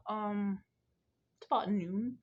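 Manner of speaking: ordinary talk, nothing marked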